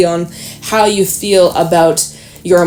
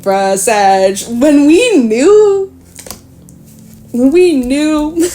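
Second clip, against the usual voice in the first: told-you-so drawl; 6 semitones higher, much slower than usual. Retold or imitated. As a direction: tease Sag that we knew all along. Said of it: "I'm like".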